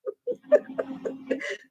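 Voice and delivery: soft wheezing laugh; helpless silent laughter; laughing too hard to voice it; breathless laugh. Real, not acted. Host bursts out laughing.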